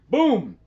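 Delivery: very loud, high boom; huge boom. A narrator's reading. Giant's fist hitting a tree, very loud boom. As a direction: smash it.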